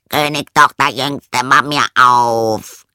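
loud frog call, last word stretched. A narrator's reading. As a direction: call through a door as a frog. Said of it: "klopfte es an die Tür und rief".

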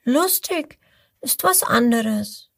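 high, slow little croc voice; little crocodile disapproving. Acted.

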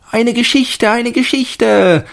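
hohe, laute Kinderstimme, gedehnt; rufende Kinder. A narrator's reading. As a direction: wie bettelnde Kinder rufen. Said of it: "riefen die Kinder". (hoch und laut wie Kinder rufen)

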